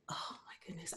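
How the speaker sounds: barely voiced, awed whisper; whispered amazement. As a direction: whisper it to yourself in disbelief. Amazed aside at daughter's familiar traits.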